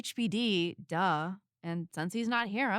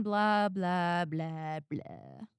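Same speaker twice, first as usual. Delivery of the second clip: dismissive blah blah; 3 semitones lower, much slower than usual. Acted. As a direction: brush off the whole speech, dropping away. Mocking the villain's reveal speech.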